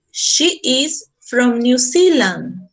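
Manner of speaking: very slow, word by word; teacher modelling "New Zealand" slowly; slow demo. Shown as a demonstration. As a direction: spell it out slowly for the class. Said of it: "significa de Nueva Zelanda".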